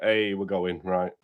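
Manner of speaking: ordinary talk, nothing marked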